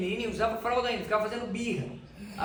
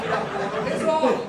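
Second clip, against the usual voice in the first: real laugh, louder and higher; laughing through it; 3 semitones higher, 4 dB louder than usual. Real, not acted. Retelling his wife's advice, laughing. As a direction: crack up mid-quote. Laughing while quoting wife Paula.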